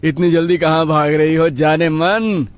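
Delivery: high, teasing call; catcalling rowdy. Acted. Rowdy following a girl off the bus, teasing catcall. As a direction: leer after her, drawing her attention.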